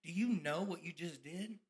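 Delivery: a low, soft voice in a different tone, sneering; the doubting voice on his shoulder, low and soft; tempting inner voice. Retold or imitated. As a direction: murmur it low like the devil on your shoulder. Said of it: "that other shoulder talking to me saying".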